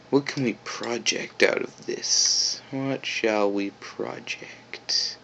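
conspiratorial unvoiced whisper. Real, not acted. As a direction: whisper the question secretly. Whispering about project time.

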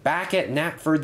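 plain reading voice

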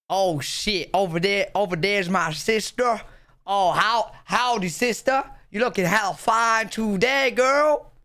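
put-on Southern US accent; American accent. Shown as a demonstration. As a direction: ham up an Alabama accent. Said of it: "Do your best American accent".

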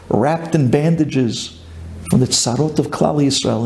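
usual speaking voice

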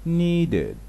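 needed said with the ending "ed" clear as its own syllable; ed sounded out. Shown as a demonstration. Teacher demonstrating the ending of needed for learners. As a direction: say needed as nee-ded. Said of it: "نسمع كده".

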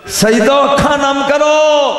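slow high shout to the crowd; preaching call. Real, not acted. Preacher urging mourners to weep, slow high shout.

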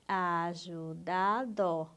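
"ajudado" very slow, each syllable held apart. Shown as a demonstration. Teacher confirming the syllable count, syllable by syllable. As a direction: stretch "ajudado" out syllable after syllable. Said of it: "separe as sílabas".

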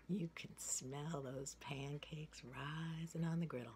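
usual speaking voice

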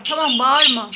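ordinary talk

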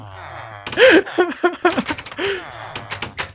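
high, giggling laugh; helpless laughter. Real, not acted. Laughing at a friend's meeting joke, high giggling. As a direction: burst of giggles.

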